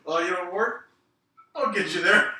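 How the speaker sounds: high, loud mock threat; acted tough challenge. Acting out wrestler's old story.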